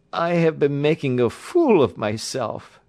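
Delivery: high, loud admission with a touch of gasping; lightly acted gasp as he recovers; mild gasp. A narrator's reading. Weary patient recovering from his hysterical outburst, gasping slightly. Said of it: "he gasped".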